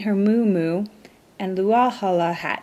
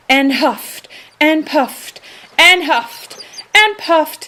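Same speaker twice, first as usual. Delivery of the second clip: building effort; 12 semitones higher, 3 dB louder, slower than usual. A narrator's reading. Shark blowing with all air.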